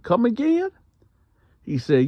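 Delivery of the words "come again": high, shocked come again; can't believe what he just heard; stunned disbelief. Retold or imitated. Asking the cellmate in total disbelief. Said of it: "I said".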